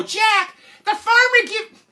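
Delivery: very high, loud exasperated mom; scolding mother. Acted. Mother scolding Jack over wet pants, exasperated. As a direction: screech at your son in mock dismay.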